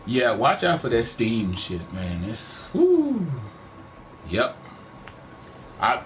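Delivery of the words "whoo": high, drawn-out "whoo"; high whoop. Real, not acted. Streamer warning about Steam sales, high whoop.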